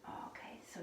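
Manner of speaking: breathy whispered okay; whispering. Real, not acted. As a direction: whisper it under your breath. Aside to herself mid-class.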